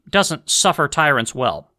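ordinary delivery

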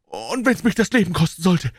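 high, desperate vow; Carruthers' frantic voice. Acted. Stranger vows to save her, high and desperate.

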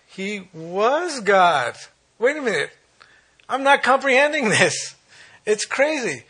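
high, loud exclamation of amazement; incredulous outburst. Acted. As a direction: cry it out as if mind-boggled. Preacher exclaiming in disbelief.

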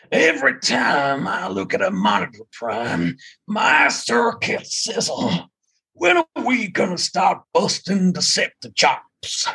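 lightly voiced gruff robot soldier; a hint of Ironside, slow on the sizzle; Ironside impression. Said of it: "half do a half decent Ironside impression".

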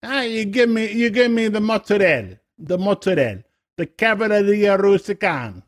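high, hammy New Jersey Italian voice; New Jersey Italian accent. Retold or imitated. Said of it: "That's New Jersey".

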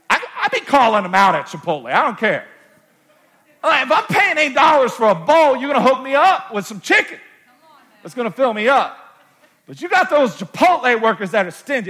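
loud, high riff, fast at the start; preacher hyped up on a joke; full-force preaching. Acted. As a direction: call them out from the pulpit. Preacher riffs loudly on Chipotle.

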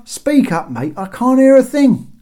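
high, loud shout down; calling from above. A narrator's reading. Guard telling Bob to speak up, shouted down. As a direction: make it carry to the boat. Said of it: "called the figure from above".

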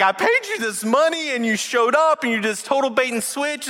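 high indignant complaint; mock outrage at being cheated; angry king. Moabite king scolds Balaam for the bait and switch, high mock outrage.

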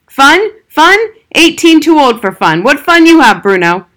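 high, loud outraged yelling; furious shout. A narrator's reading. Father shouting at his older son, furious. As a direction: bellow it in disbelief.

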